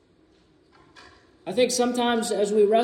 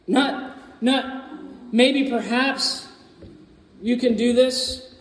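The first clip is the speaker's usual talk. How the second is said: ordinary talk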